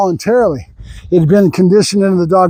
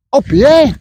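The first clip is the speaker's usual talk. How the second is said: excited cue; 6 semitones higher than usual. Real, not acted. Trainer cueing his dog to hop, high and excited. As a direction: fire the cue up to get the dog hopping.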